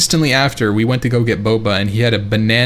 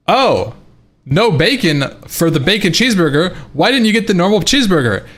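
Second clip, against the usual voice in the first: higher-pitched, swooping imitation of baffled diners; mock incredulity; 7 semitones higher than usual. Family questioning his burger order, mock incredulous. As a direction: put on the table's disbelief.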